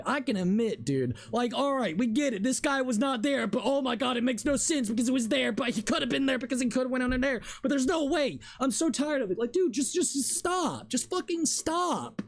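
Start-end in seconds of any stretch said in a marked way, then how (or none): 1.3-12.2 ranting higher and higher, slowing on the swears; fed-up gamer losing patience; building real exasperation. Real, not acted. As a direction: frustration boiling over, pitch climbing to the top. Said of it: "Yeah, I'm getting annoyed".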